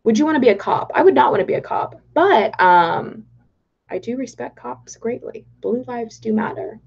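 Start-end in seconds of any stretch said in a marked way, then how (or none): none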